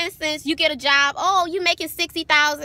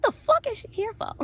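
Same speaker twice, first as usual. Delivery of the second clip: incredulous aside; 9 semitones higher, 3 dB quieter than usual. Retold or imitated. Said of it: "And I'm like".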